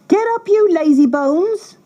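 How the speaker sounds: very high, harsh stepmother cry; stepmother barking. Acted. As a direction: yank the children out of bed. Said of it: "she cried".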